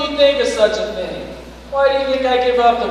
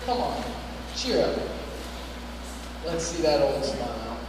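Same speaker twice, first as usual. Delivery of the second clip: tender; 8 semitones lower, 9 dB quieter than usual. Acted. Comforting softly.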